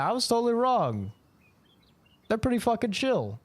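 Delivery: usual speaking voice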